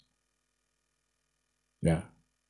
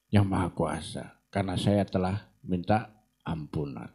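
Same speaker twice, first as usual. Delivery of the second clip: put-on sly young devil; 6 semitones lower, 7 dB quieter than usual. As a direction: lower and softer, smooth and persuasive. Devil disguised as young man speaks. (setan menyamar, suara rendah dan pelan merayu)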